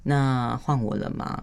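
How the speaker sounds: slow, measured request for a turn; composed, unhurried question; calm and steady. Shown as a demonstration. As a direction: ask patiently, drawing the words out evenly. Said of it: "我会说".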